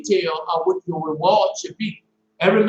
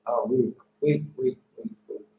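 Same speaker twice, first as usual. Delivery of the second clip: quiet, lower stream of waits; stopping himself; 6 semitones lower, 9 dB quieter than usual. Real, not acted. Preacher reining himself in, quiet and low. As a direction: catch yourself mid-rush, softly. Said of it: "Now I'm rushing".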